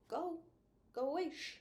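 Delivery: ordinary delivery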